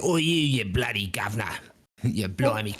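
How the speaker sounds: hammed-up Cockney devil; English accent. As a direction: put on a broad English villain accent. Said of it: "our evil folk have English accents".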